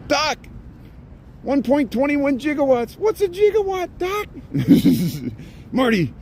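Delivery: high excited Doc Brown voice; Back to the Future impression. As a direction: squeal it like a panicked scientist.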